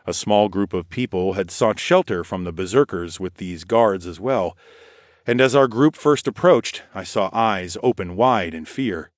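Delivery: usual speaking voice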